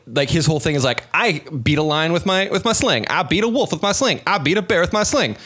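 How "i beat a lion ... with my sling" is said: higher, cocky bragging list; mock boast. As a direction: brag each feat like a show-off. David boasting his exploits to Saul.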